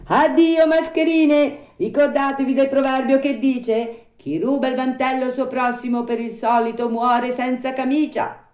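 high-pitched puppet send-off; scornful farewell. Acted. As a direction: dismiss the swindlers for good with a proverb. Puppet's final rebuff of swindlers.

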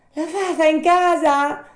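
high, loud puppet voice; Pinocchio calling up. Acted. Puppet asking for the Fairy at night, high calling voice. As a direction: call up loudly in a high puppet voice.

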